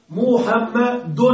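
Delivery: محمد very slowly, split as "mu-ham-ma-dun"; slow sounding-out. Shown as a demonstration. Teacher making students hear the tanween, sounded out slowly. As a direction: stretch each syllable of محمد, landing on a clear n. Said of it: "ماذا تسمع؟".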